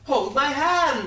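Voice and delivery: loud, high, drawn-out demand; insistent shout. Acted. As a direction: shout it slowly as an order. Repeats refused request, shouting.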